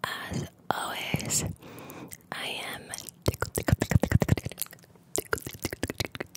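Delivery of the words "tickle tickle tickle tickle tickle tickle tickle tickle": crisp breathy tickle triggers; whispered trigger word. Shown as a demonstration. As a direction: flick each tickle crisply. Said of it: "tickled pink".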